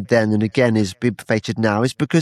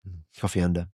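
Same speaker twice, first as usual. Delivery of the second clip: put-on "Coffee Ander" lower and softer in a joking West African accent; mock West African accent; 4 semitones lower, 10 dB quieter, faster than usual. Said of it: "that was my West African accent".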